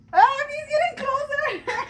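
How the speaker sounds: high, loud panicked squeal. Real, not acted. Bird creeping toward face.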